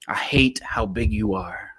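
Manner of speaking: breathy whisper on hate, voice dropped away; a whispered grudge against Texas. Acted. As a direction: hiss the confession under your breath. Spoken-word poem, hushed contrast line.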